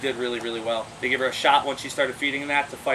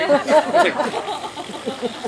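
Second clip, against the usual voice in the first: laughing; 11 semitones higher, 5 dB louder, much slower than usual. Real, not acted. Laughing at squeezed banana.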